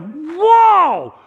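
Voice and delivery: high, loud, slow gasp of wonder; child's amazed whoa. Acted. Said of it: "He's like".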